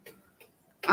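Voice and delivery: ordinary delivery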